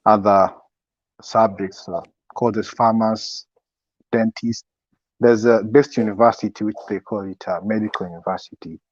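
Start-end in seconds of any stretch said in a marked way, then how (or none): none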